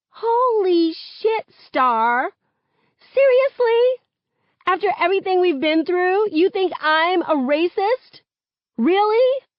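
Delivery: high loud indignant protest; offended outburst. A narrator's reading. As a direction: defend yourself, hurt and angry. Friend offended, defensive outburst.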